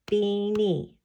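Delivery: Beanie in two even, held beats; clapped out syllables. Shown as a demonstration. Teacher clapping out a word with children, syllable by syllable. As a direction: sound out both syllables of Beanie. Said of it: "Let's clap it out".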